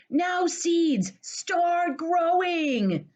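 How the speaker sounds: high, loud, slow call to the seeds. Acted. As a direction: call down to the ground, loud and drawn out. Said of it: "he said loudly".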